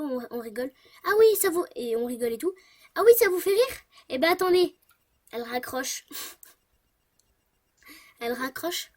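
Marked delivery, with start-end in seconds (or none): 1.0-1.6 loud, higher, indignant lady's voice; a furious woman snapping back on the phone; imitating angry lady. 3.0-4.8 loud, higher, indignant lady's voice; a furious woman snapping back on the phone; imitating angry lady.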